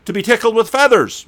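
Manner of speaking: playful mock version of "tortured"; joking misreading. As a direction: read it as a silly joke. Mock joke reading, then correction.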